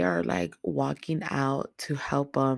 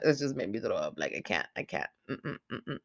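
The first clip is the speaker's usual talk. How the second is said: low, quiet, queasy recoil; sickened refusal; 7 semitones lower, 6 dB quieter than usual. Real, not acted. Reviewer nauseated by the grandma scene, sickened and refusing. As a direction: drop low and soft, as if it turns your stomach.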